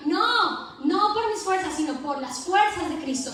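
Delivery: rising pulpit exhortation; fervent preaching. Acted. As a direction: preach it to the congregation with fire. Preaching at full force.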